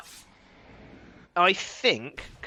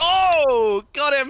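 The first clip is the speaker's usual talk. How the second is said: high, loud "Oh!" then triumphant call; excited exclamation; 11 semitones higher, 7 dB louder, much slower than usual. Real, not acted. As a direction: cry out as the kill lands. Caster reacts to a kill landing.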